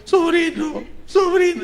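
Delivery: high, laughing call of a friendly uncle; preacher imitating a mocking coworker who now greets him fondly, high and laughing; uncle Pancho greeting. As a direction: hail the nephew with a chuckle, pitched well up. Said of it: "como el tío Pancho".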